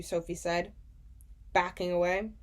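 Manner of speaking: plain reading voice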